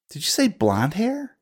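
sudden high eager question; surprised. Acted. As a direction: perk up, startled and keen. [name] excited question.

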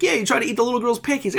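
high-pitched comic accusing voice; hammed-up squabbling accusation; mock accusation. One character accusing another over pancakes, high mock outrage.

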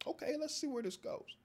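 high, giddy curiosity; thrilled to see what happens; excited. Retold or imitated. Young man excited by the drama, high and giddy. Excited about being profiled.

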